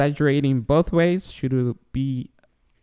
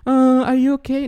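high, louder coddling voice; exaggerated concern, pitched way up; mock fussing; 11 semitones higher, 5 dB louder than usual. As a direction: imitate an over-worried parent fussing. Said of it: "I'm not like".

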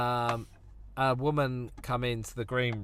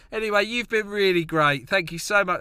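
cheesy comic sign-off; 7 semitones higher, 6 dB louder than usual. Retold or imitated. Act wrapping up early on stage.

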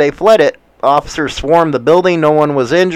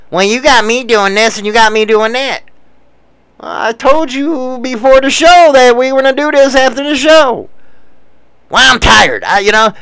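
higher sulky complaining voice; whiny girlfriend; 9 semitones higher than usual. Retold or imitated. Girlfriend China Doll complaining back, higher sulky whine. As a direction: complain like she's the wronged one. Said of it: "she does this mumbling shit".

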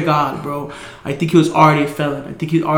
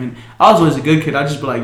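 ordinary talk

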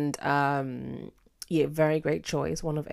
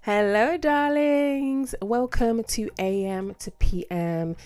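campy drag queen; 6 semitones higher, 3 dB louder, slower than usual. Acted. Podcast host greeting, campy.

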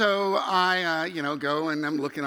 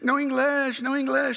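high-pitched imitation of panicked shouting; mock screaming; 8 semitones higher, 4 dB louder than usual. Family inside the pickup truck, mock screaming. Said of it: "that's screaming the entire time".

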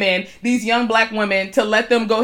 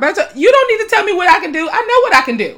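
high, loud and fast; angry retold retort; 8 semitones higher, 5 dB louder, faster than usual. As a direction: fire it back at him. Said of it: "I said that".

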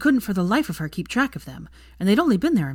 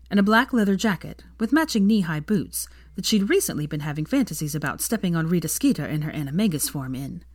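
ordinary delivery

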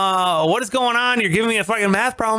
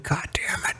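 whispered curse; 9 dB quieter than usual. Retold or imitated. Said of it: "muttered curse under breath".

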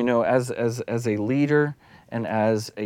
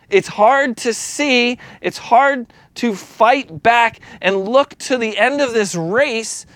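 builds to a raised, urgent preaching voice, a bit louder and higher; impassioned preaching; 6 semitones higher, 5 dB louder than usual. Acted. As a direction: pushes the congregation along, pleading harder with each whether. Rising into preaching.

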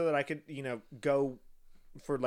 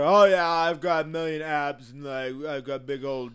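mock straight bro; 8 dB louder than usual. Mocking dating show men, boastful bro voice. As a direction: brag like a dumb muscle guy.

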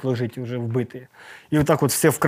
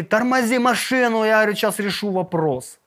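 higher, firm order; commanding order; 6 semitones higher than usual. Retold or imitated. Commander on the phone orders them to stop the car. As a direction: give the command decisively.

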